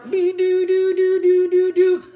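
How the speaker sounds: high monotone doo-doo-doo; hum-toot along on one high note; loud, high, steady cart noise. Acted. Imitates rolling along with the cart.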